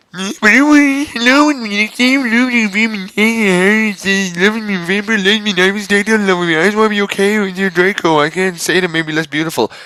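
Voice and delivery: high, silly put-on girl voice; high girly voice. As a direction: ham up a ditzy girl. Girly character voice.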